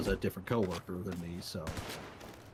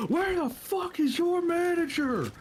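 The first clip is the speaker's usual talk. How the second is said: high, slow furious yell; angry yelling; 4 dB louder than usual. Retold or imitated. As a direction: bellow it like an irate customer. Angry customer bursts in yelling.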